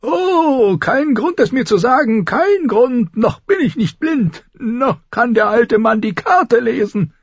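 put-on high, whining, scornful old man; old lord sneering that he is not blind; mocking old lord. A narrator's reading. Old lord mocks Caitlin.

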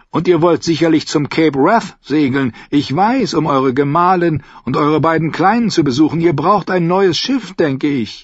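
a sly, friendly trader agreeing; Lyseni captain. Acted.

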